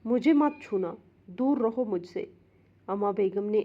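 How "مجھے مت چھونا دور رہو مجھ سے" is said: usual speaking voice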